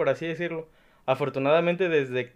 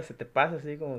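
normal talking voice

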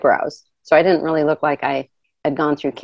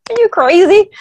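incredulous; 13 semitones higher, 5 dB louder than usual. Retold or imitated. People refusing to donate hair.

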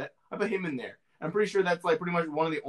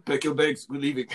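hammed-up New Zealand accent; 5 dB louder than usual. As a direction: imitate a New Zealander's speech. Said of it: "what accent is that?".

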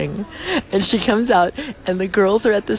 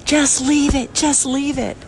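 disgusted friend; 8 semitones higher than usual. Retold or imitated. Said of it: "She's going".

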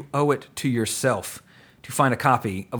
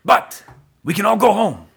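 rousing speech; 7 semitones higher, 5 dB louder than usual. Retold or imitated. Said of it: "he's like".

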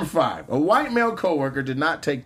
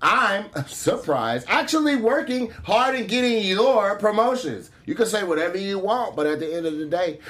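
exaggerated sister-girl comeback, pitched way up; sassy sister-girl voice; 4 semitones higher than usual. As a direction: play her as a hammed-up ratchet girl.

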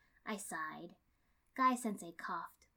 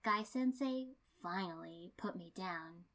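ordinary delivery

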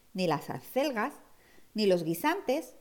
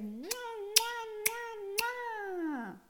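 kissing sounds; 13 semitones higher, 6 dB quieter, much slower than usual. Acted. Mother giving kisses.

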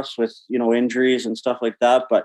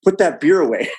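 frantic warning; 6 semitones higher than usual. Retold or imitated. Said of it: "I go".

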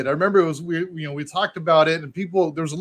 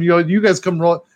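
ordinary delivery

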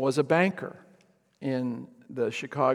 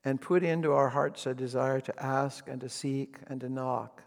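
closing prayer. Real, not acted. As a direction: pray humbly over the congregation.

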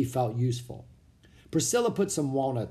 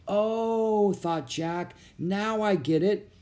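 realization; 5 semitones higher, slower than usual. A narrator's reading. Jack realizing muskets.

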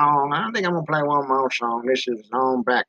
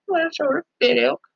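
Mama Bear voice; 13 semitones higher than usual. Retold or imitated. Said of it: "And she's like".

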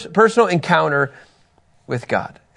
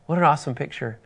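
ordinary talk

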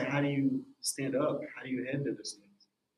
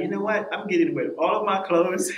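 loud, higher burst of decision; pumped-up inner voice, louder and higher; excited realization; 6 semitones higher, 8 dB louder than usual. Retold or imitated. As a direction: blurt it out like a sudden revelation. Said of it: "I'm like".

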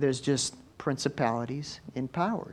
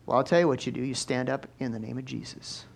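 usual speaking voice

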